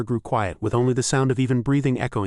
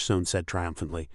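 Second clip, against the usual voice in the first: plain reading voice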